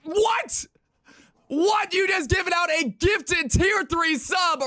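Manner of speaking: high, drawn-out shouts of amazement; hype announcer shouting. Real, not acted. Streamer celebrates a big gifted sub, high hype shouting. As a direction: belt it out like an arena hype man.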